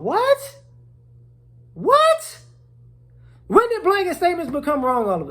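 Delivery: high, loud incredulous What. Real, not acted. Comic disbelief at blanket-statement critics.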